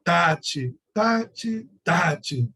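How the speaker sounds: "tati" with a weighty push on the strong beats; heavy, uneven "tati" chant; strong-weak beat demo. Shown as a demonstration. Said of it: "dentro dessa perspectiva".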